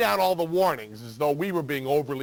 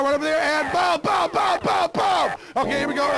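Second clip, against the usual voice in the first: high, loud, pumped-up shouts; excited shouting; 9 semitones higher, 4 dB louder than usual. Acted. Showing the sucker where to shoot, in loud fake excitement. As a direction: sell the shooting like it's thrilling.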